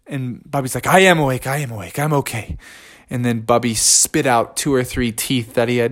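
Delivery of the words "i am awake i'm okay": a groggy mumble from the ground; hurt bear reassuring his friends faintly; weak murmur. Acted. Dazed Bubby answers friends.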